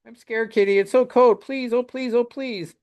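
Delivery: put-on high, pleading little boy; scared child voice. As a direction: beg like a frightened child. Frightened child voiced from comic.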